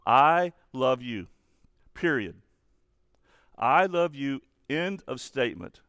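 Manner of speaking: loud, stretched I LOVE YOU, then a decisive PERIOD; emphatic declaration. Acted. God declaring love without conditions, emphatic and final. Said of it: "'cause God says".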